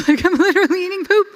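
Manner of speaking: high, fast, loud laughing disbelief. Real, not acted. Telling viewers about eating the bat, laughing hard. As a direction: laugh it out in disgust.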